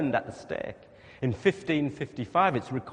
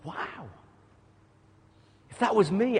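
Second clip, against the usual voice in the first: ordinary talk, nothing marked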